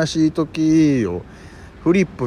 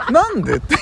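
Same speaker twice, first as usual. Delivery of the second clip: laughing; 4 dB louder than usual. Real, not acted. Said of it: "laughing at memory".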